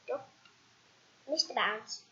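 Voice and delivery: usual speaking voice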